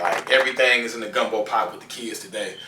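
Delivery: normal talking voice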